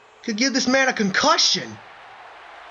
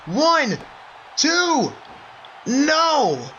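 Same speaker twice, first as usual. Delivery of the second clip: excited count; 5 semitones higher, much slower than usual. Acted. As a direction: shout the near fall. Near fall count.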